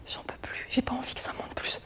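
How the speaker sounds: exhausted whisper to herself; tired whispered inner thought; whispered aside. Acted. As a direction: breathe it out, at the end of her rope.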